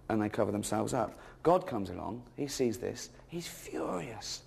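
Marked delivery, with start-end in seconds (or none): 3.3-4.4 slow, hushed dramatic build. Acted. Comic retelling Genesis, slow hushed dramatic setup. Setting up an angry God.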